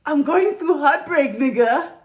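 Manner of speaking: hammed-up despair cry; mock heartbroken outburst. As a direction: overplay the heartbreak for laughs. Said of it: "That energy".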